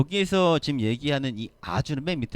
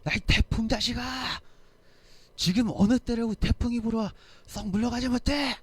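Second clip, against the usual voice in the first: yelling at the storm; 11 semitones higher than usual. Retold or imitated. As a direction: scold the typhoon like a madman. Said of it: "막 이렇게 소리 지르는 사람". (태풍을 향해 높고 크게 호통치는 목소리)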